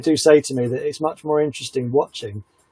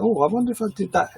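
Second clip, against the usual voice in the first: higher, lightly voiced self-doubt; higher voice, wondering aloud; mock wondering; 7 semitones higher, 4 dB quieter than usual. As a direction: play it as quietly second-guessing yourself. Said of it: "I just like".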